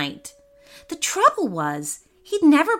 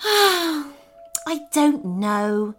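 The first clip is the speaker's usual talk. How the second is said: sad and slow; 7 semitones higher, much slower than usual. Acted. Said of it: "said the prince".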